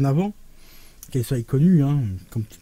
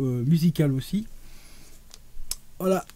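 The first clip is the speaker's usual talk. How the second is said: usual speaking voice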